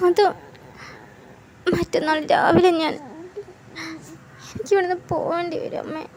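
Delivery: tearful daughter. Acted. Daughter tells mother, crying.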